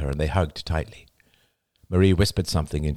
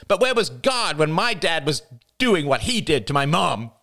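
high, bitter, accusing outburst; venomous passion; 10 semitones higher than usual. A narrator's reading. Jada accusing the praying family, high venomous voice. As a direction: spit out the grievances. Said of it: "Her voice trembled with venomous passion".

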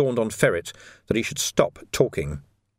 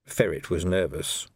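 ordinary delivery, nothing marked

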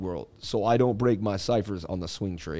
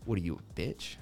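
low, soft voice in the head; taunting inner voice; 5 semitones lower, 9 dB quieter than usual. Acted. Inner voice daring himself at the pump, low taunt. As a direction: drop low and quiet to taunt yourself. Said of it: "and my voice goes".

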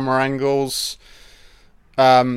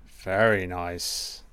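much softer and slower, savouring it; soft slow murmur; 5 semitones lower, 7 dB quieter, slower than usual. Shown as a demonstration. Film fan admiring the opening titles, murmured softly. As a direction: murmur it to yourself, drawn out.

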